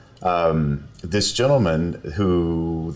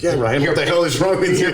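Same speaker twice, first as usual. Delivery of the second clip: loud, high-pitched inner voice berating himself; mock self-scolding; 11 semitones higher, 6 dB louder than usual. Lawyer scolding himself for standing up, mock exasperation. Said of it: "part of me is like".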